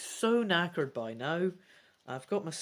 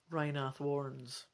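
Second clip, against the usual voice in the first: usual speaking voice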